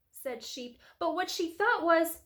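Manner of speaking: usual speaking voice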